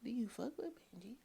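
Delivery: fast, hushed whisper. Pretend. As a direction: lean in and whisper it. Conspiratorial question about the song.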